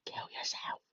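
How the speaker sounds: faint breathy whisper; whispered taunt. Acted. Taunting a rival driver in the race, whispered. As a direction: hiss it under your breath.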